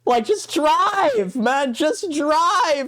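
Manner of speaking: high, drawn-out outraged plea; pleading high in sheer disbelief; exasperated rant. Real, not acted. Ranting about Uber costs.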